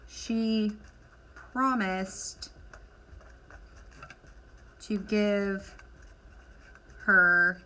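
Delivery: word by word with long pauses; slow dictation. Shown as a demonstration. Teacher to class filling in chart, slow dictation. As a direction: spell it out slowly so students can copy.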